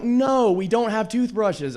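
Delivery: over it, spell it out; slow, fed-up no; exasperated. Retold or imitated. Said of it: "I was like".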